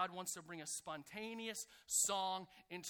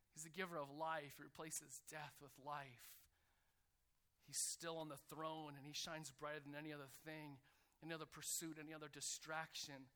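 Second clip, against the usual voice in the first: soft, lower-pitched worship declaration; hushed reverence; 4 semitones lower, 6 dB quieter than usual. Acted. Worshipper declares what is in his heart, hushed reverence. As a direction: speak it tenderly, as private praise.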